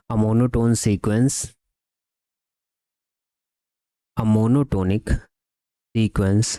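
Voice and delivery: measured, slow delivery for note-takers; slow dictation. Real, not acted. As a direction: dictate word by word, with long pauses. Dictating the theorem's other wording.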